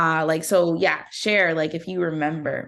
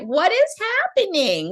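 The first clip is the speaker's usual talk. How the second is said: high, baffled cry of confusion; 突然拔高，满是困惑; bewildered; 11 semitones higher than usual. Retold or imitated. Panicked self retold after birth.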